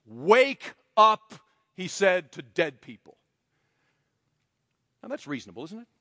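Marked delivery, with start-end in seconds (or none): 0.1-1.4 retold shout. Wesley rebuking dead men.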